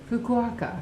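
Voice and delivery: Fukuoka said as "foo-KWAH-kuh"; movie mispronunciation of Fukuoka. Retold or imitated. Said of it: "The guy pronounced it".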